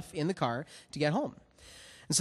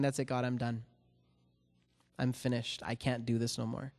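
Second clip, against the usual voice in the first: softer and lower, a spent surrender; defeated giving up; 5 semitones lower, 9 dB quieter than usual. Retold or imitated. Worn-out man telling God he quits, soft and defeated. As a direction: let the words drop out, beaten. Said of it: "looking up at God and saying".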